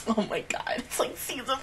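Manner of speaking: stunned unvoiced whisper; real whisper. Real, not acted. Viewer stunned by the episode, whispering. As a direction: whisper it in shock.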